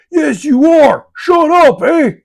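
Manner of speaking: high, loud comic shout; mock shouting back. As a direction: yell back at a stubborn pot. Shouting back at the yelling pot.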